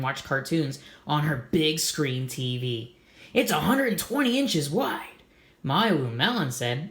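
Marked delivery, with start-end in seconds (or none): 3.3-5.2 put-on high bragging girl's voice; proud little girl bragging; boasting kid. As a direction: show off the big TV. Gertie boasting of her TV.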